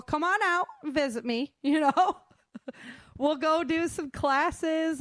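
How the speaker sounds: normal talking voice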